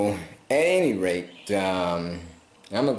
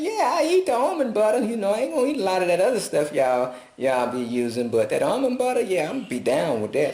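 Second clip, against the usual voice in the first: higher, eager, gushing yes with a fast middle; excited acceptance; 11 semitones higher, faster than usual. Retold or imitated. Telling how he happily took the owners' almond butter, excited. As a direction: grab the offer like a gift. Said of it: "I said".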